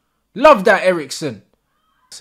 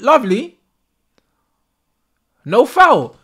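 normal talking voice

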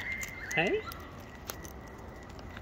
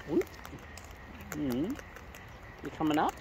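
ordinary talk, nothing marked

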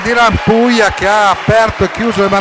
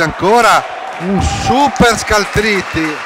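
ordinary talk, nothing marked

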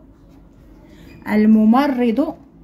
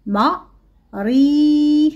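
slow dictation; 2 semitones higher, much slower than usual. Shown as a demonstration. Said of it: "نعاود نكتبوها".

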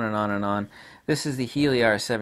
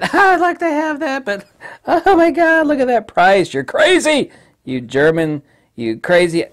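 much higher and louder, mock-shocked customer complaining about price; outraged buyer; 10 dB louder than usual. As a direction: ham up a buyer balking at the cost. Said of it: "because they said".

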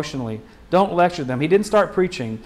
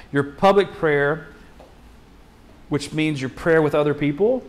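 ordinary talk, nothing marked

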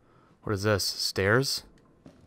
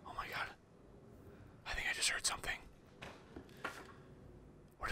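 whisper; 26 dB quieter, faster than usual. Real, not acted. Hiding in a horror game.

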